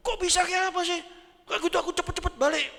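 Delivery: high, loud and fast, upset; alarmed wife. Acted. Wife on the phone hearing the dog is gone, high loud and rushed. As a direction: rush the words out like a frantic wife.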